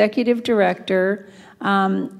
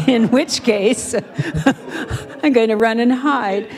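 laughing; 4 semitones higher than usual. Real, not acted. Joking about embarrassment.

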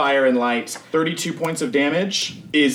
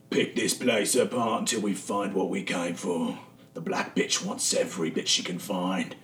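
menacing boss; 5 semitones lower, 6 dB quieter than usual. Acted. Gang leader gives orders.

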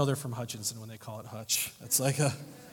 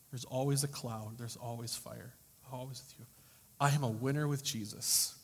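earnest reading; 6 dB louder than usual. Acted. Reads letter's faith lines.

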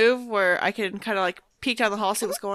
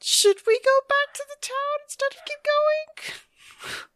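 much higher, slow, anxious; high worried character voice; slower than usual. Retold or imitated. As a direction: pitch it way up and fret. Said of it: "She's like".

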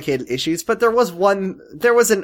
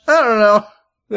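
mock hesitant; 5 semitones higher, 4 dB louder, much slower than usual. Acted George answer.

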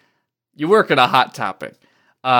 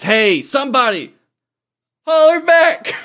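high, loud holler for anyone; hollered plea for a reply; calling out; 11 semitones higher, 5 dB louder than usual. Acted. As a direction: call out across the distance, pitched way up. Character calls out for anyone.

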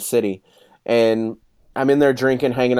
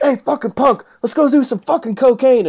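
put-on much higher, raspy-free rowdy old-timer voice; imitating old barfly; 12 semitones higher, 3 dB louder than usual. Old cat urging young punk to the bathroom, high rowdy voice. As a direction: goad him like a wild old drinker.

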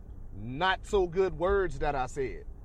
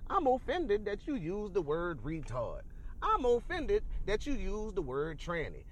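slow, higher-pitched mock complaint; mock offended; 4 semitones higher than usual. Imitating people who object to words, mock offended. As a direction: draw out each grievance, imitating the easily offended. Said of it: "listen to people and say".

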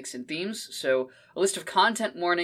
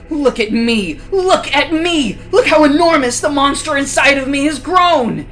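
high, loud, swelling roar; monstrous gloating roar; 9 semitones higher, 9 dB louder than usual. Acted. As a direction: gloat like a beast. Monster bursts out again.

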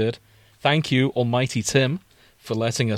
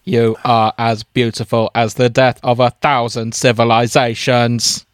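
slow, loud, stilted Dalek proclamation; robotic alien wooing, at full volume; 6 dB louder, slower than usual. Acted. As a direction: declaim each word like a Dalek. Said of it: "I specialise in Dalek voices".

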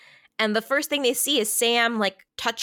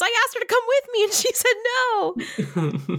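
mock Richie excuse; 12 semitones higher than usual. Retelling Richie's excuse, mocking.

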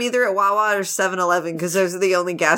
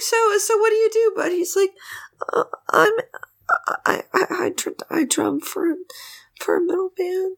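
high nervous voice; 10 semitones higher than usual. Acted. Acting out shy drummer reply.

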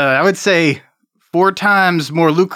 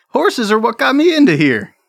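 higher, proud criminal voice; Jeremy NPC voice; 9 semitones higher than usual. Acted. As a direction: boast about his past. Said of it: "Jeremy says".

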